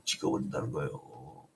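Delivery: very low, soft echo; hushed repeat. Real, not acted. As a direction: drop right down in pitch and volume. Same verdict repeated as a low echo.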